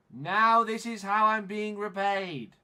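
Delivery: loud, high, drawn-out complaint; indignant shout. Real, not acted. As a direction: shout it slowly in outrage. Repeats the complaint, now shouting.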